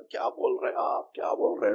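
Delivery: hushed, breathless whisper of a moved listener; awed murmur in the crowd; amazed whisper. Retold or imitated. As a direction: whisper it as if overwhelmed by the speech. Listeners react to a moving speech.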